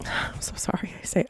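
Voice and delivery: breathy sigh then a soft whisper; whispered apology. Real, not acted. True-crime vlogger apologizing to viewers in a whisper. Apologizing mid-story, sighing.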